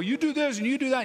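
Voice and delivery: high, hurried mock pressuring voice; pushy urging. People pressuring a waiting believer, pushy urging. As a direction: press someone insistently in a high, quick voice.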